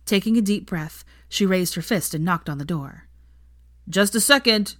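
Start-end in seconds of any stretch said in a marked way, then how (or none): 3.9-4.8 louder, higher call from inside; shouting out. A narrator's reading. Ron answering a knock on the door, calling out. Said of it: "a familiar voice shout out".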